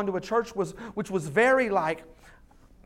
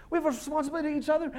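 much faster rattled-off repeat; hurried second take; rapid repeat; 8 semitones higher, 3 dB louder, much faster than usual. Shown as a demonstration. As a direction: fire the line off quickly.

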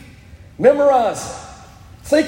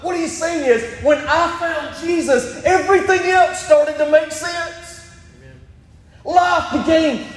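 higher, building preacher's shout; preaching at full force; 4 semitones higher than usual. Acted. Preacher to his church, rising to a shout. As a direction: lift the congregation, climbing to a shout.

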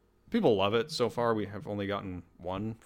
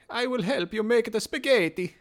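high-pitched, put-on French chef voice; hammy mock French accent, pitched way up; bad French accent; 11 semitones higher than usual. Chef offering to help Americans with spaghetti, bad French accent. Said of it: "It's like the French, the French voice".

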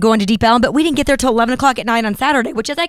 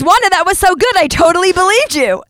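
impressed friend; 9 semitones higher than usual. Retold or imitated. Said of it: "my friend Brett goes".

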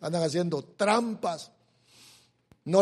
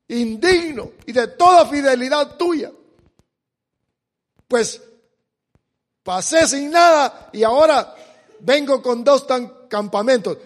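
preaching at full force; 5 semitones higher, 10 dB louder than usual. Acted. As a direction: proclaim the verse to the congregation. Reading Jacob's prayer with force.

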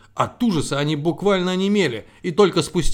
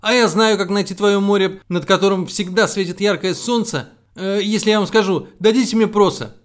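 eager sparrow; 6 semitones higher, 5 dB louder than usual. A narrator's reading.

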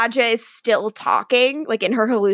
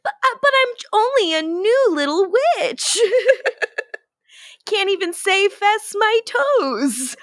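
little witch voice; 9 semitones higher than usual. Retold or imitated. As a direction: play a timid new witch. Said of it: "But I've only been doing magic".

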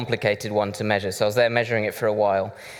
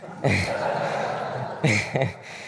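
laugh; 3 dB quieter than usual. Real, not acted. Laughing at his own joke.

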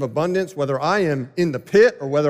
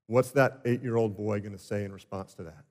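usual speaking voice

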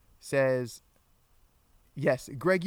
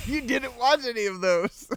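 higher laughing accusation; giggly higher voice; laughing tease; 9 semitones higher, 4 dB louder than usual. Real, not acted. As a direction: tease her through a laugh. Teasing wife, laughing.

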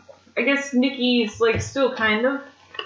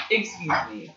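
deadpan; 4 dB quieter than usual. Real, not acted. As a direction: say it dry and unimpressed. Outraged at a squash match.

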